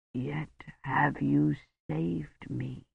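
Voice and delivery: slow breathless whisper; dying whisper. A narrator's reading. Dying Roche whispers his thanks to Kivrin. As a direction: breathe the words out.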